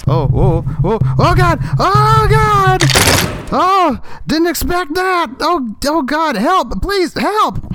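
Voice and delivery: high, frantic cries for help; panicked cries. Acted. Skit handyman panicking at a wallpaper surprise, high and frantic. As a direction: panic as something jumps out.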